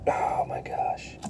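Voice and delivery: slow, whispered exclamation; hushed dismay. Real, not acted. Reacting to the Brussels sprout tower, hushed dismay. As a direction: whisper it under your breath, drawn out. Dismayed at his teetering food stack.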